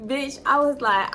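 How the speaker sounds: ordinary delivery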